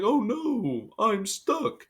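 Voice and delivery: high, hammed-up helpless cry; mock distress. Wrestler hanging out of the shark cage, mock distressed. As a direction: wail it in a badly faked panic. Mocks Dominic's staged predicament.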